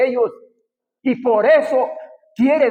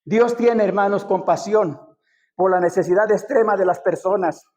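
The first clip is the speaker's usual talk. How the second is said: ordinary talk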